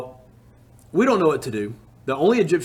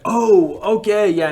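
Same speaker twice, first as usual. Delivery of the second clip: pleased surprise; 6 semitones higher, 5 dB louder, slower than usual. Retold or imitated. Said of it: "he's like".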